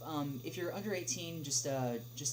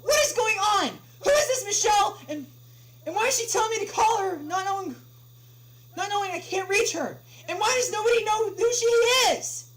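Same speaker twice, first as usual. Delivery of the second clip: much higher, much louder outraged shouting; furious screaming; 17 dB louder than usual. Acted. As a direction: scream in outrage at the useless clerk. Caller screams at clerk.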